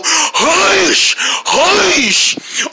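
high hacking groan, falling away; hairball groan. Acted. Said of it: "trying to mumble up a big hairball".

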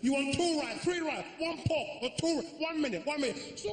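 very high mock Chinese accent; high-pitched flustered Chinese caricature; confused waiter. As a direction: get muddled in a shrieky Chinese accent. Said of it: "I want him to get so confused".

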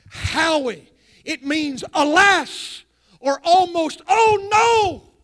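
high, loud, slow explanation; preaching the Hebrew meaning with fire; emphatic preaching. Acted. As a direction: drive the word home to the crowd.

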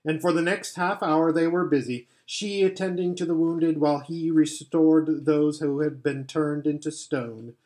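plain reading voice

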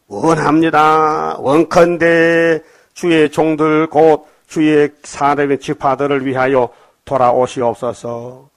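very slow, drawn-out plea; solemn scripture reading. Acted. Preacher reads the Israelites' plea to the congregation, slow and pleading. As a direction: intone it like a prayer.

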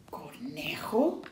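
soft, slow questioning murmur; hushed wondering; thinking to oneself. A narrator's reading. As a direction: puzzle over it quietly, tune rising. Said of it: "pensó el zorro".

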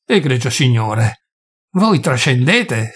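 slow, restrained warning; hissed warning. A narrator's reading. Offended host warning the doctor he goes too far, hissing. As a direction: measure it out with contained anger. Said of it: "sibilò Abogin".

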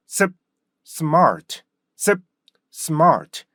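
short stopped 습, then smart falling with an r; started with 습. Shown as a demonstration. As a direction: cut 습 shut, pause, release smart in one beat. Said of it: "습으로 시작해 보세요". (습 끊고 R 살려 smart)

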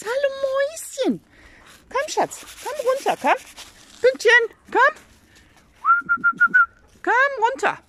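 high, sing-song coaxing call. Real, not acted. Owner coaxing her hens down from the tree, high sing-song call. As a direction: lure the hens down sweetly and high.

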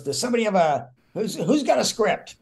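high, pushed call across the set; bossy Mickey Rooney. Retold or imitated. Mickey calls to the crew for a script, bossy and high. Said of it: "now he's running the show".

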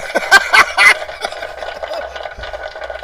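loud high wheeze laugh; high wheezing laugh. Real, not acted. Big laugh.

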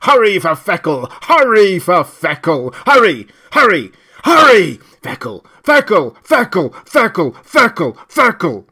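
loud, high, furious barking; enraged scream. A narrator's reading. Loudspeaker ordering the block to buy now, screaming with rage. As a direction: shout orders in a rage, building to repeated cries. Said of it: "The voice screamed inarticulately with rage".

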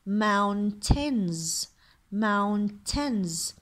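mountains split slowly into "moun... tenz"; mountains, long "mown", then short-vowel "tenz"; syllable-by-syllable pronunciation. Shown as a demonstration. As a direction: sound out mountains in two stretched syllables. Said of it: "عندي ال I مثل الكسرة".

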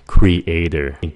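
slow creator, cre-A-tor; syllables separated. Shown as a demonstration. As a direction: stretch "creator" into three clear syllables. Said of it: "tách nó ra".

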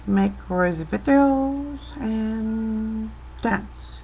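ordinary delivery

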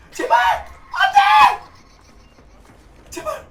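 real panic as the killer closes in; desperate pleading. Real, not acted. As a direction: cry out please over and over, panicking. Desperately hoping not to be caught.

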